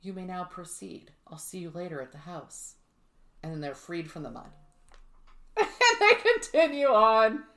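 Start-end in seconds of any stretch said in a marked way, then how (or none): 0.0-2.7 soft, understated ghostly voice; hushed, composed quote of a witch; female spirit voice. Retold or imitated. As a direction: say it quietly and evenly, as a spirit. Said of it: "a female voice comes and says".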